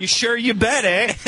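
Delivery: put-on a light Yooper accent ending with eh; mock Yooper accent. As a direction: play along in a Yooper accent. Host answers in a mock accent.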